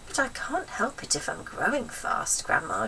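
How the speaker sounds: put-on timid boy pleading with his gran. George voiced in story.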